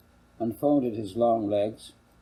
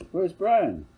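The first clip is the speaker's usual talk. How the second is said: put-on high, louder kid's question; lightly voiced boy; 9 semitones higher, 5 dB louder than usual. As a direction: ask like a curious boy. Boy asks after brother.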